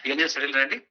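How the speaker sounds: ordinary talk, nothing marked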